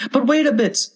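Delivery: high-pitched little oyster voice; small creatures calling out, high; oysters crying out. A narrator's reading. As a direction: pipe up in high protest. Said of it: "the oysters cried".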